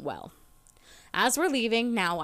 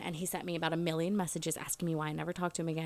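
normal talking voice

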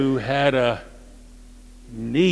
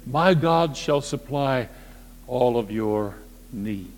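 solemn, slow; 5 semitones lower, 3 dB quieter than usual. Acted. Solemn scripture quote, slowed.

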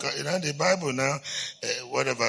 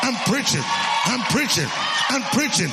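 higher and louder, driving preacher cadence; fiery preaching; 12 semitones higher, 3 dB louder than usual. Acted. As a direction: preach it breathlessly like fire. Preaching the story at full force.

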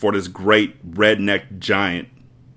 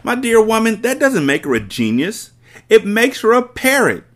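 much higher, louder sneer; mocking headmistress; 13 semitones higher, 5 dB louder than usual. Headmistress sneering at Miss Honey, high and loud. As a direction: ridicule the whole idea.

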